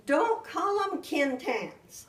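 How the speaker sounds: put-on high, loud, slow whiny child protest; a small daughter's upset whine; upset little girl. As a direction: plead like a hurt little girl.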